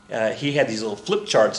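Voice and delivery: ordinary talk, nothing marked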